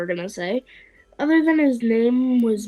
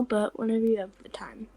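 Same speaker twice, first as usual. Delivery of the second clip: hushed aside; whispering; 4 semitones lower, 8 dB quieter, faster than usual. Acted. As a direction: whisper it under the breath. Character aside, unvoiced.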